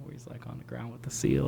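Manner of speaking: ordinary talk, nothing marked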